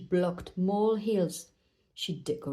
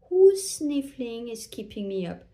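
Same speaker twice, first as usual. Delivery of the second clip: louder opening, mildly put-out owl; lightly voiced owl, disturbed by noise; grumbling Owl; 3 semitones higher, 7 dB louder than usual. A narrator's reading. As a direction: call out who's disturbing you. Owl disturbed, calls out from hollow.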